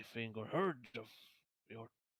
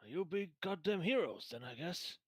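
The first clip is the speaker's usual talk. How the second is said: put-on raised, pitched-up young soldier asking eagerly; npc soldier voice; 6 semitones higher than usual. GM voicing a soldier, asking the party, high and eager.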